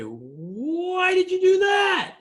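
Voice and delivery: high, slow, outraged yell; indignant shout. Retold or imitated. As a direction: demand an answer, shouting and drawn out. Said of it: "my first instinct was to say".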